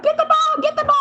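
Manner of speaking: high, loud yell out a car window; yelling frantically at the dog owner; retold yell. Driver yelling to a stranger to grab his dog, high frantic yell. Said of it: "I'm, like, yelling out the window".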